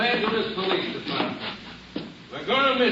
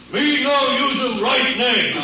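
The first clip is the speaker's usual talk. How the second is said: higher, louder and slow, hammed-up chief voice; mock Indian chief punchline; 5 semitones higher, 5 dB louder, slower than usual. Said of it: "The Indian said".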